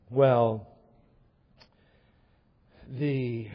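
low, very slow pulpit pronouncement; weighty, measured sermon point; grave preaching. Real, not acted. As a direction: let each phrase land with long pauses.

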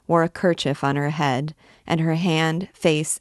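plain reading voice

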